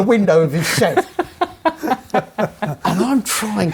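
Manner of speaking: telling a gory mishap while laughing. Real, not acted. To friends recalling the cut, laughing.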